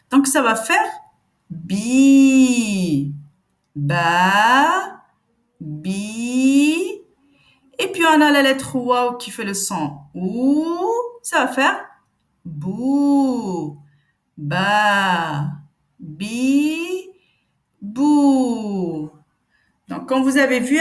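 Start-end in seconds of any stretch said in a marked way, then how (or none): 1.5-7.0 "bi", "Ba", "bi" each held and said clearly; a teacher's careful "Ba, bi"; model syllables. Shown as a demonstration. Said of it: "je vais venir prononcer chaque lettre". 12.4-19.1 "Ba", "bi", "bou" drawn out one by one; model syllables. Shown as a demonstration. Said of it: "je vais venir prononcer chaque lettre".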